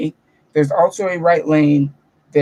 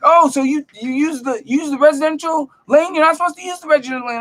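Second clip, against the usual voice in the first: mock scolding guard; 10 semitones higher than usual. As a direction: lecture the driver like a fussy guard. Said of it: "he's just yelling at me".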